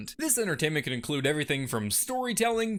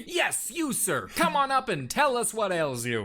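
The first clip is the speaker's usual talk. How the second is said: normal talking voice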